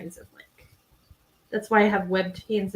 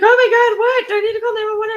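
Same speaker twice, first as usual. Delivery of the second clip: high, loud alarmed outburst; mock panicked friend; 13 semitones higher, 9 dB louder, faster than usual. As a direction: imitate a friend reacting to a scream. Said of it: "scream and she's like".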